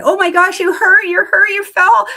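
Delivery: put-on high, loud alarmed gasping over a fallen toddler; panicky parent. Parent overreacting to the toddler's fall, high and alarmed. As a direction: overreact like a frightened mum. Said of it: "And if the parent is saying".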